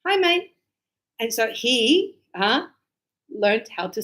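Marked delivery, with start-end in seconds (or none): 0.1-0.5 high, loud hi mate; cheery greeting. Retold or imitated. Translating the students' greeting, high and cheery. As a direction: chirp it like a matey hello.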